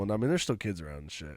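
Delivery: normal talking voice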